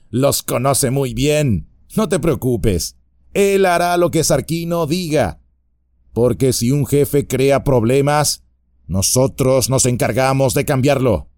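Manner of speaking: bar their way with a sneer; burly bandit voice, taunting; menacing bandit. Acted. Bandit threatens Frodo.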